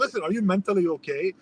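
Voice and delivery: subdued, pointed aside. Retold or imitated. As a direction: ask it quietly, checking on someone. His quieter question before her outburst.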